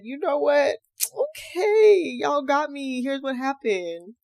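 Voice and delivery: high, loud caving in, okay on top; panicked confession. Pretend. Caught liar giving up to the police, high and loud. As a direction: blurt it all out at once. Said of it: "I would have been ready to shit a brick".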